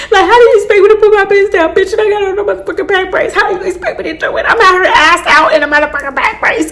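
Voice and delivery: high, fast, crying complaint; tearful whine. Retold or imitated. Retelling the clinic shot to viewers, a high crying whine. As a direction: wail it out half crying and half laughing.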